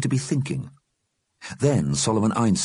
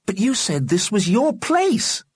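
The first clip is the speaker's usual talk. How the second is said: put-on boy's voice. Johnny argues with the dead.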